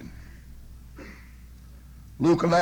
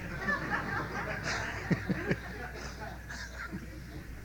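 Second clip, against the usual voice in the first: real laugh; 11 dB quieter than usual. Real, not acted. Laughs at own joke.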